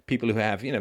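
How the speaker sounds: normal talking voice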